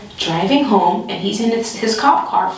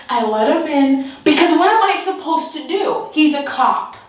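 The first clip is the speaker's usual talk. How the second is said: usual speaking voice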